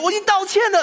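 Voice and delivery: high, loud wail as if bursting into tears; crying protest. Retold or imitated. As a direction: sob it out like a child in tears. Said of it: "然后就开始爆哭". (哭喊着大声说)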